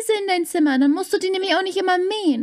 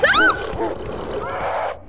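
startled yelp. Real, not acted. Sudden startled cry.

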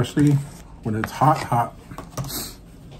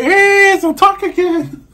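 very high, loud mock wife; squeaky bossy wife impression; imitating wife; 8 dB louder, slower than usual. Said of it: "She's like".

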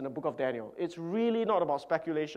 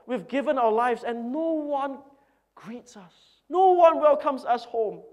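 building from a hushed start to louder, higher protest; a bitter old missionary venting; hurt man complaining to his wife; upset complaint; 6 semitones higher, 5 dB louder than usual. Retold or imitated. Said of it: "became very upset".

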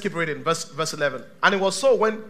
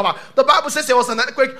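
ordinary talk, nothing marked

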